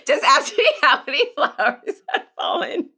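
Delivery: laughing through the words; trying to hold back a laugh; stifled giggles. Real, not acted. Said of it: "I'm trying not to laugh".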